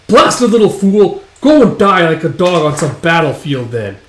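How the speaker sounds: acted indignant tirade; angry curse. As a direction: rail at him as he leaves. Spurned employer curses Guts.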